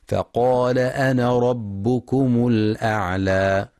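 slow, drawn-out tajweed chanting; measured melodic Quran recitation. Shown as a demonstration. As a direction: recite with full ghunna and long vowels. Recited verse.

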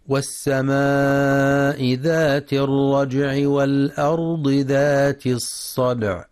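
slow, measured tajweed chant; sustained, level-toned tajweed recitation; Quran recitation. Acted. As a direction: recite with long, held vowels and nasal hums.

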